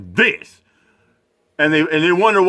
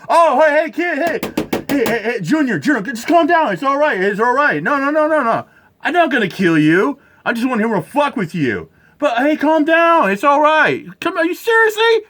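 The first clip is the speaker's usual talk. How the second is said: higher, hammed-up soothing cop voice; mock soothing cop; 7 semitones higher than usual. Mocking the officer calming a crying kid, higher hammed-up coo. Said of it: "mocking the cop soothing kids".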